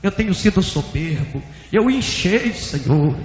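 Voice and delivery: penitent pleading. Retold or imitated. Pastor face in the dust confessing to God, urgent pleading. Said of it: "colocou o rosto no pó e falou".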